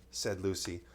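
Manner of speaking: ordinary delivery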